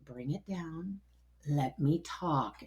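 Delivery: very soft, low, measured; quiet, slow, low-pitched request; calming down. Retold or imitated. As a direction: hush someone who is overexcited. Doctor calms the excited speaker.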